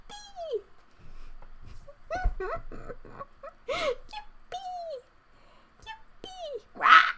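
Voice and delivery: tiny squeaky yippee, very high and soft; very high soft squeaky yippee with a laugh; funny noise. Pretend. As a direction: squeak it like a small toy. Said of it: "making me make funny noises".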